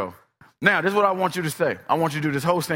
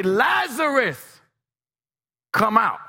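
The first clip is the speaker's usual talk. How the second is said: LAZARUS drawn out high, then a pause; stretched, emphatic summons on "Lazarus"; pointed call by name; 4 semitones higher, slower than usual. Retold or imitated. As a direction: call "Lazarus" slowly and higher, then "come out". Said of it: "He had to specifically say".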